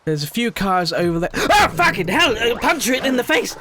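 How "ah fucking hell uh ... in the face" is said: real startled yell, high and loud. Real, not acted. Player jumped by a stalking wolf, startled yell. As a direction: jolt into a high, loud cry of fright. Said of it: "Scared the hell out of me".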